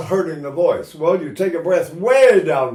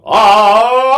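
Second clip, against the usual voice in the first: one loud punched ah, higher; hit the first one; 6 semitones higher, 11 dB louder than usual. Shown as a demonstration. Singer showing how to hit only the first accent, loud. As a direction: hammer the first note then lean. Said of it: "hit the first one and go".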